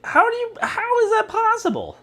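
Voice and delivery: very high-pitched squeaky disbelief; incredulous. Real, not acted. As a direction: voice leaps way up, baffled. Baffled by the impossible answer.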